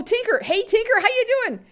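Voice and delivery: very high, loud squeal of welcome; shrieky delighted hail; excited greeting. Real, not acted. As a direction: shout hello as if thrilled to see them. Greeting a viewer arriving.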